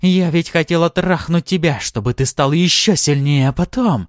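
high-pitched, forceful anger; furious outburst. A narrator's reading. Succubus blurting out her plan, furious. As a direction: rant it, then break off.

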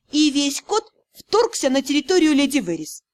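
ordinary delivery, nothing marked

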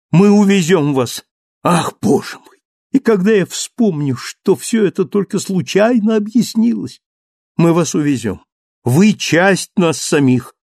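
high, loud, fervent insistence; impassioned Marius. Acted. Marius insists on taking Valjean away.

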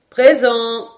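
loud reply called down from high up; voice from above. A narrator's reading. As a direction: answer from the treetop. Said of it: "une voix qui venait du ciel". (réponse lancée d'en haut)

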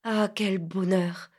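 slow, sighing delight; slow dreamy sigh of happiness. A narrator's reading. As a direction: let it out slowly on a sigh. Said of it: "comme il eût soupiré".

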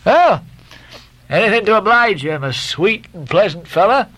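high, sugary mock-sweet reply; sly obliging. A narrator's reading. Stubb slyly agreeing to help the Guernseyman. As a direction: play the friendly schemer.

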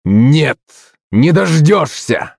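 high, drawn-out angry cry; stretched, furious exclamation; fierce shout. A narrator's reading. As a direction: refuse with sudden fury. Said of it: "закричал князь, моментально свирепея".

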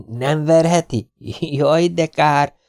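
put-on high, slow, drawn-out boy's sigh of regret; milk the disappointment; disappointed boy. Domi disappointed. (magas, lassú, csalódott kisfiúhang)

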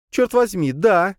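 восторженный высокий выкрик; крикнуть с восторгом; excited. Acted. Julia cheers. (восторженный крик)

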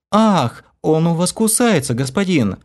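high, whiny plaintive complaint. A narrator's reading. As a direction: complain pitifully. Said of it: "жалобно пожаловалась".

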